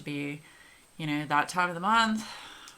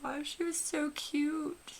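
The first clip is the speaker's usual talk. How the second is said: doting cute-talk; 10 semitones higher than usual. Real, not acted. Vlogger cooing to viewers over the dog photo, high doting voice. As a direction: coo over the puppy on your lap.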